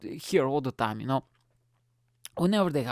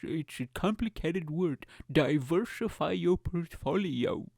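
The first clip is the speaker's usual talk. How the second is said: mock investment guru; 3 semitones higher than usual. Plays a stock market advice-giver.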